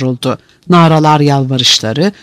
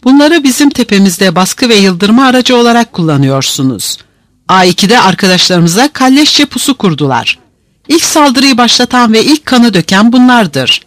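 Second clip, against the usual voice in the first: raised, high-pitched accusation; protesting; 7 semitones higher than usual. A narrator's reading. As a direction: keep objecting indignantly. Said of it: "itirazlar devam etti".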